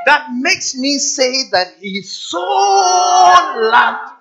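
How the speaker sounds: high, loud, slowing almost to song; fervent preaching shout. Acted. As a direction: stretch the question out, rousing the crowd. Preacher shouting rhetorical questions.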